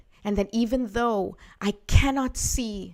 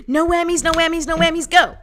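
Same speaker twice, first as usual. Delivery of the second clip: loud, high, rapid-fire chant; game-show contestant; 8 semitones higher, 7 dB louder than usual. Retold or imitated. Reader urging the card energy along, game-show chant. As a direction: chant it like a contestant willing the board to stop. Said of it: "you know the reference".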